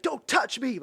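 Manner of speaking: high, loud yelp of protest; imitated yell. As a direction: yell it like the man being grabbed. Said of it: "Like, he yells it".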